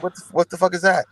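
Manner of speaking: high, puzzled what-the-fuck; baffled outburst. Retold or imitated. Teen to angry brother, high baffled yelp. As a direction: play dumb, voice jumping up.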